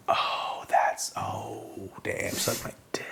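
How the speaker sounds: voiceless whisper of dismay; whispered realisation. Retold or imitated. Realising who owns the venue, dismayed whisper. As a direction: whisper it as it dawns on you.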